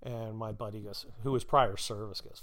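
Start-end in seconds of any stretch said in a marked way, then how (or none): none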